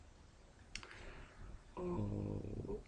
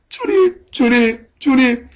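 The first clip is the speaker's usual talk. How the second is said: high, tearful cries, voice breaking; crying out to God; 10 semitones higher, much slower than usual. Retold or imitated. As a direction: sob out the name in anguish. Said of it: "엉엉 울면서". (높은 목소리로 흐느끼며 부르짖기)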